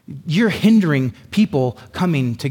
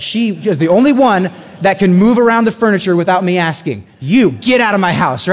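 louder, higher mock outrage over the furniture; mock outraged wife; 6 semitones higher, 8 dB louder than usual. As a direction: ham up the wife guarding her furniture. Wife defending her furniture, acted.

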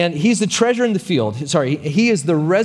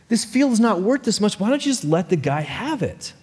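much higher pleading protest; shocked wife; 8 semitones higher than usual. Acted. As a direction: talk your husband out of madness. Wife protests husband's plan.

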